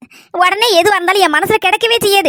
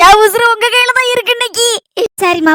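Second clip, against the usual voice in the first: normal talking voice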